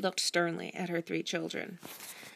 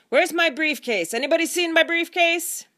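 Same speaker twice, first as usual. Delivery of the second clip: higher and louder call from upstairs; 11 semitones higher, 8 dB louder than usual. Acted. Father calling downstairs for his lost briefcase, loud. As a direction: call through the house for it.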